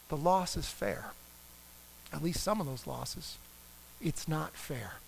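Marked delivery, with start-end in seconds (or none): 4.0-5.0 softly, hurt and low; quiet hurt complaint. Pretend. Griever admitting the loss: quiet, hurt complaint. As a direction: murmur it like a grievance you've held inside. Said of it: "you might actually get angry".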